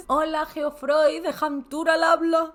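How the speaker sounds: loud, slow, formal gentleman's voice; a pompous dad picks up the phone; father on the phone. Acted. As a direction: announce yourself like a stiff businessman. Voicing the father answering.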